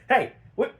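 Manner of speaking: mock bodyguard yelling at a kid; gruff bodyguards. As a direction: shoo the kid off like a bouncer. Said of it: "and they're like".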